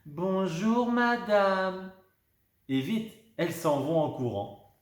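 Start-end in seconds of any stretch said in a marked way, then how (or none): 0.0-1.9 bright high greeting in chorus; cheerful little girls greeting together; joyful. A narrator's reading. (bonjour joyeux, aigu)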